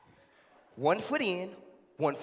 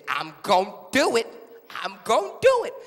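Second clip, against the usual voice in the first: loud, rising in pitch on the repeat; emphatic threat; 3 semitones higher, 9 dB louder than usual. Acted. Mom insists to her child she will jump, loud. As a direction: insist on it, building higher and louder.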